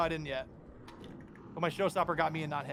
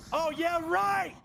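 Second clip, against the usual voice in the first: sarcastic shout; 13 semitones higher, 6 dB louder, much slower than usual. Real, not acted. Enemy shooting, sarcastic cry.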